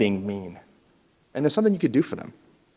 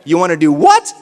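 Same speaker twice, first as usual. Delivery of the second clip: louder cue; 5 semitones higher, 9 dB louder than usual. Shown as a demonstration. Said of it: "Now I'm gonna say no".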